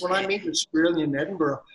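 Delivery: usual speaking voice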